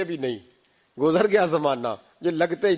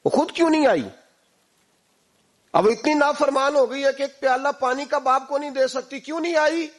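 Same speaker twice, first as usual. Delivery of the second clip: much higher, indignant scolding; angry father; 9 semitones higher than usual. Acted. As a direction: scold the absent daughter in rising anger. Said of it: "کو غصہ آیا". (غصے میں ڈانٹتا ہوا باپ)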